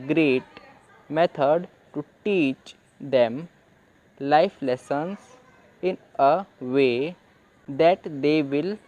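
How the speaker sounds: very slow, word by word, with pauses; slow reading aloud. Shown as a demonstration. Teacher reading the English line slowly to learners. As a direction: read it out slowly as a model. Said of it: "तो यहाँ पे मैंने इसे will क्यों बोला".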